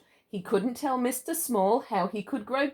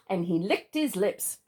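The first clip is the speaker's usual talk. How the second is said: usual speaking voice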